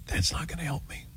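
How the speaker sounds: whispered aside; "That's not gonna help me" under the breath. Real, not acted. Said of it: "whispered aside".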